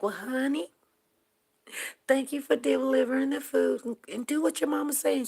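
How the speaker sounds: high, loud motherly stranger; kind stranger. Retold or imitated. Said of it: "She was like".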